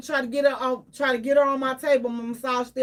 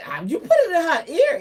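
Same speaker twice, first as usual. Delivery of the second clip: usual speaking voice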